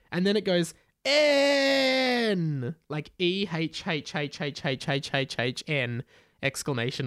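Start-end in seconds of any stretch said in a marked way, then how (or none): 1.0-2.7 long high hammed-up screech; a drawn-out mock cry of pain. Mister Charger being attacked, a long high screech of pain. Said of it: "the N was a screech of pain".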